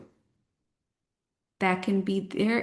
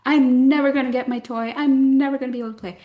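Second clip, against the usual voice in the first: put-on much higher, louder, fast whining child; whiny kindergartner; 6 semitones higher, 6 dB louder, faster than usual. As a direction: wail it like a frustrated little kid. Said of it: "a lot of never ever going on in kindergarten".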